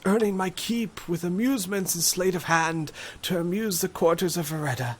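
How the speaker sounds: put-on high, modest old magician voice; shaky old voice.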